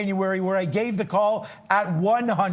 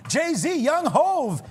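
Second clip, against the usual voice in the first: excited; 8 semitones higher, much slower than usual. Real, not acted. Announcing a big guest.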